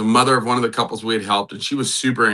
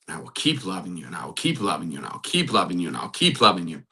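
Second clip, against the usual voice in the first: quiet, lower mantra, fading each time; soft repetition; 5 semitones lower, 6 dB quieter, faster than usual. Shown as a demonstration. Pastor softly repeating an affirmation to calm himself, quiet and low. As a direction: murmur it to yourself, gently. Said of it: "Something repetitive that is beneficial".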